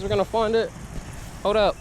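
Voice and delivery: ordinary delivery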